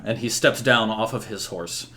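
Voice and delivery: ordinary delivery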